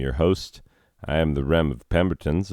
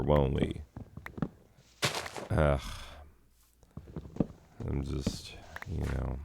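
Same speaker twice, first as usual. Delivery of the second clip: muttering to self; 6 dB quieter than usual. Real, not acted. Quiet muttering while gathering.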